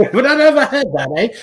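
very high mock British-Canadian accent; British-sounding Canadian accent. Mocking his co-host's Canadian impression, high British-tinged Canadian accent. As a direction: parody a bad Canadian accent. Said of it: "It sounds like a British guy".

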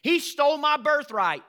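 loud, aggrieved mock complaint; whining he stole my birthright; mock victim whine. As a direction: play the wronged victim, loudly.

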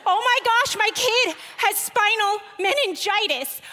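high, loud, frantic reenacted panic; panicked. Rookie mom panicking over Google search: frantic high panic. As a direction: shriek it like a terrified new mom.

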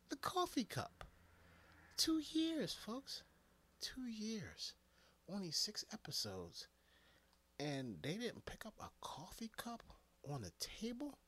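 hushed disbelief. Real, not acted. Incredulous hushed rant.

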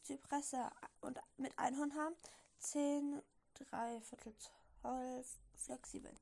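plain reading voice

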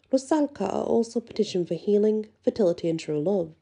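ordinary talk

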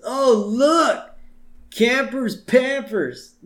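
high, loud singling-out taunt; hammed-up playground mockery; mock bully jeer. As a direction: jeer at him like a cartoon bully. Nelson mocking the uniform.

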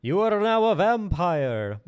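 high, loud, slow evil genie; genie vampire voice. Acted. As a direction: grant it with a wicked laugh. Genie grants wish.

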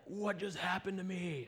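slow, high-pitched bewilderment; drawn-out shocked question, retold; imitating freaked-out man. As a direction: act stunned, just come back to life. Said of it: "he's, like, freaking out".

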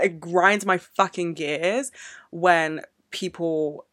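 ordinary delivery

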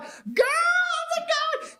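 very high mock squeal; imitating an excited woman, drawn out slow; pitch goes up. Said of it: "the pitch goes up".

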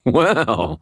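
put-on high, loud drunken giddiness; drunken laughing. A narrator's reading. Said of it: "I started laughing like a drunk".

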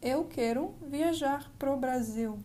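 "Eu quero viajar pro Brasil" said slowly, in careful Portuguese; slow model sentence, every sound of "Brasil" clear; Portuguese demo. Shown as a demonstration. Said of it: "So why don't we say".

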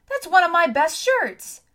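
high, loud outraged complaint; indignant protest. A narrator's reading. Boy to girl using his shirt as bandage, indignant. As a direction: protest loudly, voice shooting up.

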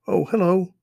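put-on high, loud friendly boy; young boy voice. A narrator's reading. Strange boy greets the lost children, high friendly voice. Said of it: "a voice said".

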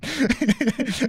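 high laugh at your own story; amused laugh. Real, not acted. Storyteller amused by his own demand, real laugh. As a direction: crack up after the punchline.

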